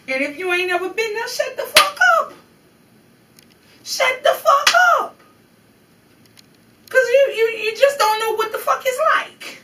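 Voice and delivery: real high-pitched angry shouting; loud, heated rebuke at the top of the voice; furious outburst. Real, not acted. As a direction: yell at your critics, voice way up. Emotional rebuke to judging viewers.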